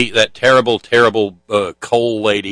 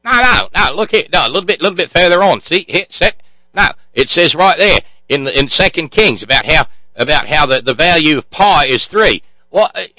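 higher-pitched, flustered Aussie teacher; cheesy Australian accent; 6 semitones higher, 4 dB louder than usual. Acted. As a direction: insist in a hammed-up Australian accent. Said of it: "my cheesy Australian accent".

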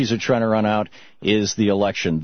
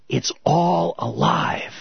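awed realization; 7 semitones higher, much slower than usual. Retold or imitated. His awed realization of the secret.

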